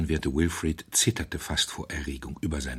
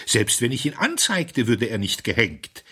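wild blissful smile; 5 semitones higher, 5 dB louder than usual. A narrator's reading. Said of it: "mit einem wilden, aber merkwürdig seligen Lächeln".

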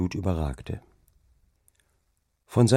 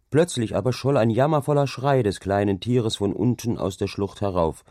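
ordinary delivery

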